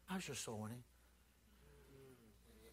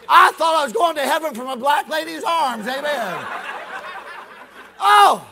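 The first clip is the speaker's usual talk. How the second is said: loud, higher, hammering preacher delivery; preaching at full force; 12 semitones higher, 18 dB louder than usual. Acted. Evangelist telling the congregation his conversion story, shouting. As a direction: hold the revival pitch all the way.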